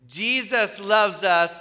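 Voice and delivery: normal talking voice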